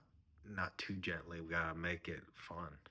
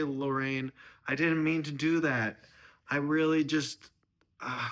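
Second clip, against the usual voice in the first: high, raised earnest pleading; pleading apology; 7 semitones higher, 7 dB louder than usual. Acted. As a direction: plead your case, high and insistent. Son-in-law pleads and apologizes.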